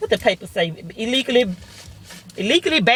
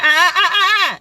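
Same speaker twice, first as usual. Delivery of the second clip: mocking laugh; 9 semitones higher, 3 dB louder than usual. Laughing at the tow hustle.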